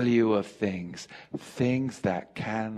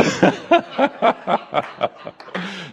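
loud laugh; 8 semitones higher, 6 dB louder than usual. Real, not acted. Preacher laughing at own slip.

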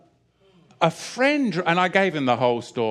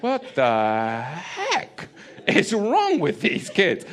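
put-on slow, bewildered kid voice with a laugh; mock baffled kids; 5 semitones higher, much slower than usual. Secular kids staring at climbing homeschoolers, slow baffled voice. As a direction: stretch it out like puzzled children.

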